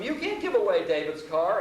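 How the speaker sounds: upset protest. Retold or imitated. Ex-professor objecting to giving away a friend's car, upset protest. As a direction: object loudly, pitch jumping up. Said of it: "I'm getting all upset".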